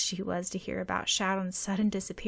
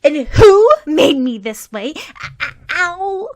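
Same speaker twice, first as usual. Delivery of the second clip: loud, high indignant shout, then a yelp; an annoyed girl yelling at the boy who hurt her; angry demand; 8 semitones higher, 16 dB louder, slower than usual. A narrator's reading. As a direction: hands on hips, furious. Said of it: "Mei Lin demanded".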